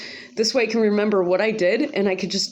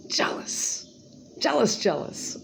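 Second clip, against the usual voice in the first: whispered taunt; 3 semitones higher, 5 dB quieter, slower than usual. Real, not acted. Taunting whisper about neighbor.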